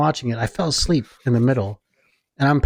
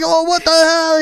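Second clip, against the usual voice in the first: high-pitched mock anger; worked-up, high outrage; dad getting mad; 4 dB louder than usual. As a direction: imitate a father losing his temper. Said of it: "getting all mad".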